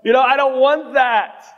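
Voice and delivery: high-pitched mock-panicked protest; mock terrified. Preacher acts out refusing the Holy Spirit's call, mock terrified. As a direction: shoot up high, pleading out of it. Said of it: "I was terrified".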